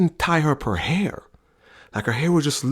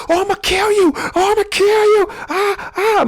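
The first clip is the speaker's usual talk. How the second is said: high, loud imitated yelling, much higher and louder; mock yell; 6 dB louder than usual. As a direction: scream threats in a high, loud voice. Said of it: "And she was just yelling".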